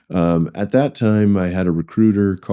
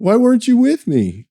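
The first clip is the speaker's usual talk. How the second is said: very high, hammed-up aggrieved question; mock indignant complaint. Asking the boss who skipped the meeting, mock indignant. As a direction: plead your grievance in a comically high voice. Junior analyst confronting absent boss.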